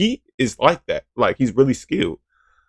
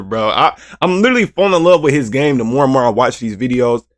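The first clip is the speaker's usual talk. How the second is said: ordinary delivery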